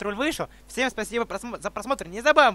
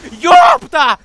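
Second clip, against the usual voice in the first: surprised shout; 5 semitones higher, 14 dB louder than usual. Real, not acted. Yelling in surprise.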